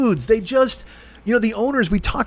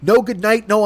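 normal talking voice

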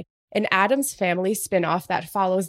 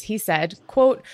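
ordinary delivery

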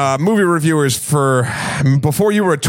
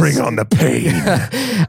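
mock tough guy; 4 semitones lower, much slower than usual. Mock braced for criticism.

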